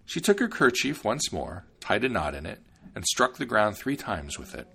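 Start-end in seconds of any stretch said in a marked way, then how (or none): none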